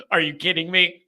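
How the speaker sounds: ordinary talk, nothing marked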